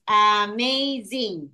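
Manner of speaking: "Amazing" slowly split into three beats; syllable by syllable. Shown as a demonstration. Teacher showing three syllables to pupil, "Amazing" slowly syllable by syllable. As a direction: sound out "Amazing" with each syllable apart. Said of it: "Three syllables".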